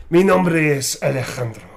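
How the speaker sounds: a lightly sexy Spanish line; mild mock seduction in Spanish; a hint of a Spanish seducer.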